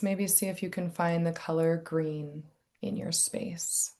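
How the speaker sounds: soft, hushed meditation guide; quiet, unhurried grounding cues with space to look around; guided meditation. Acted. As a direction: lead them gently through noticing the room, softly.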